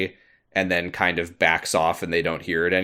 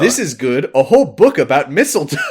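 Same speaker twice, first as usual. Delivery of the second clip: higher, hammed-up delight; mock excited discovery; 5 semitones higher, 6 dB louder than usual. The doctor finding a book on mistletoe, mock excited. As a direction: announce a great find, playfully overdone. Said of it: "everyone's yelling over each other".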